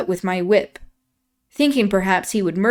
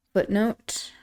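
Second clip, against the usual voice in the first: plain reading voice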